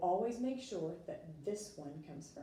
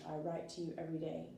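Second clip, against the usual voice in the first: fast run-through of the sentence; quick example; faster than usual. Shown as a demonstration. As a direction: rattle it off quickly. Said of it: "for example".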